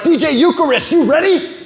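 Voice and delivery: high, hyped club DJ shout-out; pumped-up DJ intro, pitched high; hype DJ call. Retold or imitated. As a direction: call it out like a party DJ hyping the crowd. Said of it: "They're like".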